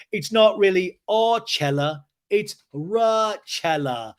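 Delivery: slow, high, stretched-out imitation; James's proud announcement. Recapper imitating James unveiling Rochella, slowly grandiose. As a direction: draw out each word like a grand reveal.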